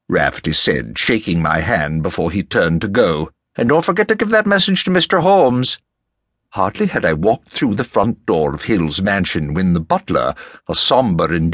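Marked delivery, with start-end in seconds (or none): 3.6-5.8 high-pitched genial Irishman calling back; parting reminder. Acted. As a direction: call a cheery reminder after someone. Rafferty calls a parting reminder.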